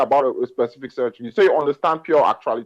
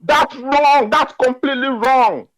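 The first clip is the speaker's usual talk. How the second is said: raised, heated insistence; insistent; 8 semitones higher than usual. Real, not acted. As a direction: push back hard and high. Heated debate, insisting opponent wrong.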